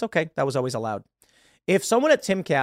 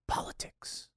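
whispered aside; 14 dB quieter, slower than usual. Acted. Host whispering the risky topic to his audience, hushed aside. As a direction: drop to a sly whisper on the last word.

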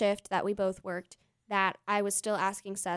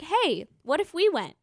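eager, high, bright ask; excited; 8 semitones higher, 5 dB louder than usual. Retold or imitated. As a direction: pitch it up, keen to go. Eager ask in retold quote.

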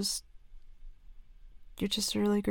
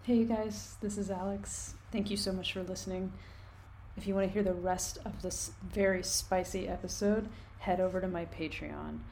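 ad read; 4 dB quieter than usual. Real, not acted. Patreon outro read.

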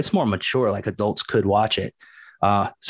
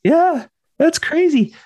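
amazed; 6 dB louder, slower than usual. Real, not acted. Reacting to Marvel bankruptcy.

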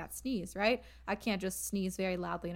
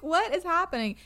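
surprised; 7 semitones higher, 6 dB louder than usual. Retold or imitated. Reacting to sister's sneeze.

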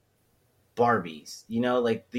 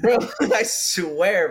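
slow, louder, broken by laughter; laughing; 6 semitones higher, 5 dB louder, much slower than usual. Real, not acted. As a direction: crack up mid-phrase. Laughing about his mom.

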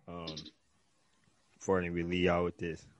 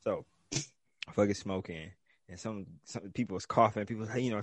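usual speaking voice

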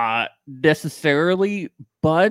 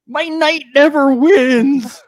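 slow, much higher lament; mock lament; 12 semitones higher, 5 dB louder, slower than usual. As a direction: wail it slowly. Joke line, slow.

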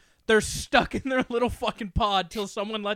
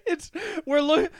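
ordinary talk, nothing marked

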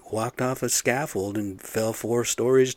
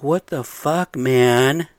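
loud, higher, slowing furious yell; angry yelling; 5 semitones higher, 7 dB louder, much slower than usual. Retold or imitated. Yelling at electricians in muddy boots, angry loud shout. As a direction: blow up at them, dragging out the last words. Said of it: "I'm like".

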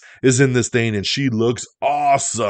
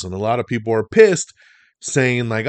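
ordinary talk, nothing marked

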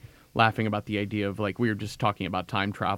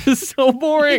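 high, loud, laughing mock whine of boredom; mock exasperation; 9 dB louder than usual. Mocking a boring radio show to her co-hosts, loud laughing whine. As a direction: wail about how dull the show is.

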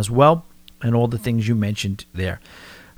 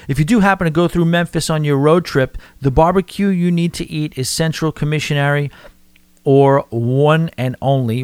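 normal talking voice

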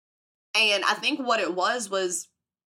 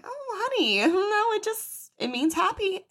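high, sweet condescending teacher; mock teacher; 12 semitones higher than usual. Teacher soothing a confused kid, high and sugary. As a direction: coo it like a patronising teacher. Teacher gently correcting the child.

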